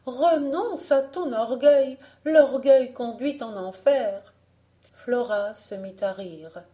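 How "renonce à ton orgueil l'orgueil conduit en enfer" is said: high, loud, vehement warning; exclaimed admonition. A narrator's reading. Mother warning her daughter of hell, crying out. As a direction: exhort forcefully, voice raised. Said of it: "s'écria Marie-Jeanne".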